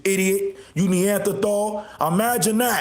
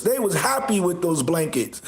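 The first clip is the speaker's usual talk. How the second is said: ordinary talk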